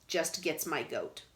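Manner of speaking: ordinary delivery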